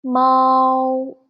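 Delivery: mao held high and level, first tone; pinyin syllable mao. Shown as a demonstration. Teacher blends the syllable for students, clear first tone. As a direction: join initial and final into mao, high and steady. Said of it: "So together".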